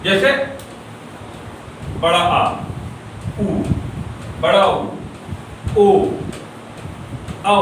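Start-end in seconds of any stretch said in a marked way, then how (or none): 2.0-7.6 isolated back vowels बड़ा आ, उ, ओ, औ with pauses between; vowel demo. Shown as a demonstration. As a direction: say आ उ ओ औ slowly one after another. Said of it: "ये चारों आप बोलेंगे".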